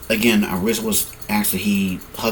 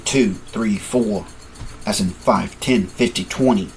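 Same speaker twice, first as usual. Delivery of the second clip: plain reading voice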